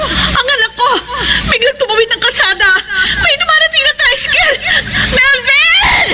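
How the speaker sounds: mother shrieking as her son runs into traffic; panicked cry. Acted. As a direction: scream in terror for the child. Mother sees son crossing road.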